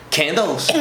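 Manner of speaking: imitating a mocking "Candles?"; mocking query. American tourist teasing the guide over his English, mocking query. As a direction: CANDLES as a feigned-puzzled question. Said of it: "Tipo, sacaneando".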